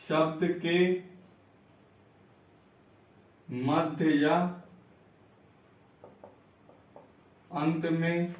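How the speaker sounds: slow, low, soft word-by-word dictation; quiet, drawn-out teacher dictating for note-takers. Shown as a demonstration. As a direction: dictate it piece by piece with long pauses.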